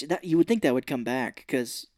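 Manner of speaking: usual speaking voice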